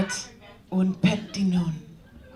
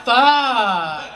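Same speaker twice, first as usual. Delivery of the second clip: mock frustrated curse; 5 semitones higher, 8 dB louder than usual. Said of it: "I was like".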